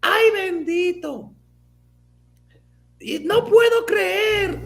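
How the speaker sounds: high-pitched, slow, drawn-out Spanish lament of a scandalized mother; imitating upset mom. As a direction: wail it like a shocked Dominican mom. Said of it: "She was like".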